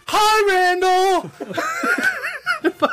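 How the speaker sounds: very high, drawn-out holler across the office; sudden high-pitched shouted hello; yelled greeting. Retold or imitated. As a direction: yell it to startle a coworker. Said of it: "But I yelled him at".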